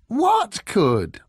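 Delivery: high, loud, exasperated question. A narrator's reading. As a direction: demand an answer, out of patience.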